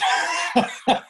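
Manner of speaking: short breathy chuckle; laugh. Real, not acted. Laughing after telling how he answered back to the boss, amused chuckle. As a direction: chuckle at your own nerve. Laughs at his own nerve.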